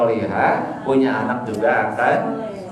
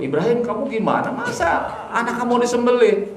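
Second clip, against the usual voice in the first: higher, wheedling mock devil; tempting devil; 7 semitones higher than usual. Preacher voicing Satan tempting Ibrahim, higher and wheedling. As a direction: needle him like a sly tempter.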